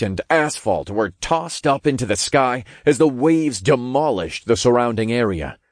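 plain reading voice

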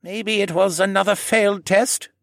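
a hint of the kindly old koala; old man voice. A narrator's reading. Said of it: "Jenner offered".

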